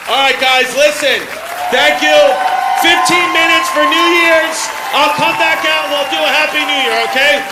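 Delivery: loud, high sign-off to the crowd; calling out. Real, not acted. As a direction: shout over the room to wrap up. Calling out to close the set.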